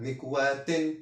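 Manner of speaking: مكواة ending in -in, "mikwatin"; double kasra ending. Shown as a demonstration. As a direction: say مكواة with a final -tin. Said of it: "كسرتان". (مكواة بتنوين الكسر في آخرها)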